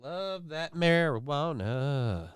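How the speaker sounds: slow, drawn-out sigh of pleasure; slow after a hit. Real, not acted. After taking a hit, a slow savouring remark. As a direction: savour it slowly.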